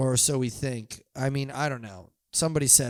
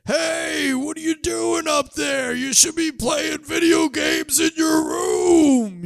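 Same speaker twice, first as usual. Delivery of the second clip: much higher, louder, slow shout up to him; teasing holler at a graduation; mock yell; 7 dB louder, slower than usual. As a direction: call up to the brother from far below. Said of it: "I didn't really have a lot to yell at him".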